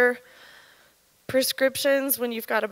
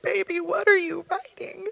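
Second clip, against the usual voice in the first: tearful plea; 7 semitones higher than usual. Retold or imitated. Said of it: "his eyes just pooled".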